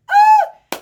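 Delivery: high excited squeal. Real, not acted. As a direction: squeal with joy.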